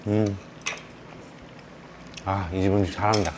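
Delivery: chewing while talking; talking between bites; mouth full of rice. Real, not acted. Seen 0: Takes rice bite at -2; closed-lip chewing motions while talking after.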